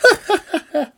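high, loud giggle; high-pitched burst of amused laughter; aunt's laugh. A narrator's reading. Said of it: "musste auch seine Tante lachen".

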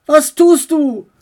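high, loud, slow cry; boy cries out as bird is thrown; calling out. Acted. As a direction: cry out in alarm. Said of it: "rief Max".

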